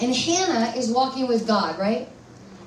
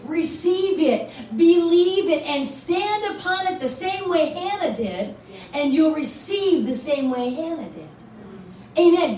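exhortation; 4 semitones higher than usual. Acted. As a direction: call the church to believe. Altar-call exhortation.

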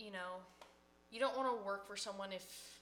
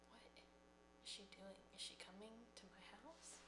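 soft puzzled aside, much softer; hushed aside; 17 dB quieter than usual. Retold or imitated. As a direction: murmur it to yourself, puzzled. Car pulls into her driveway.

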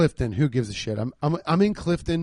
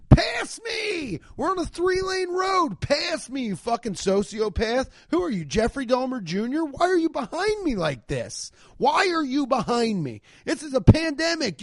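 high, shrill-pitched yelling, much higher; imagined shouting rant; 10 semitones higher than usual. Pretend. Yelling at the cyclist on the three-lane road, high frantic shout. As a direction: scream it at him in disbelief. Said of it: "I wanted to say".

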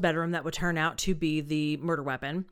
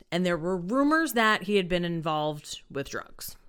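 ordinary delivery

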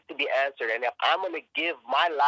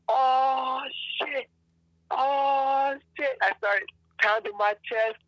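overwhelmed exclaiming; 8 semitones higher than usual. Retold or imitated. Retelling waking up alive on the rock, high emotional outburst. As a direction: burst out, voice cracking with awe and defiance.

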